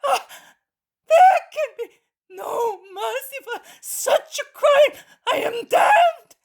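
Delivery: crying out very high and loud, sobbing; despairing wail; anguished shouting. A narrator's reading. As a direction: beg for mercy through sobs. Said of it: "covering his face and shouting".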